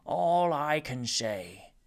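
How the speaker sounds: slow, deliberate gentleman; a lightly voiced dignified Rumford; measured farewell speech. Rumford speaking from cocoon.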